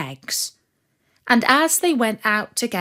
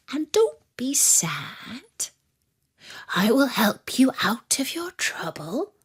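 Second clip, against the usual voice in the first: whispering wolf. Acted. The wolf whispering his plan to the sad dog, conspiratorial. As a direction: lay out the secret plan in a hushed whisper.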